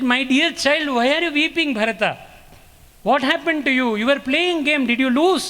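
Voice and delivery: put-on a fussing mother comforting her crying child; worried mother. Mother Kausalya voiced consoling Bharata.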